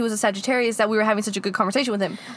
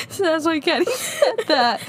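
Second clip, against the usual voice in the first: laughing while talking; 5 semitones higher than usual. Real, not acted.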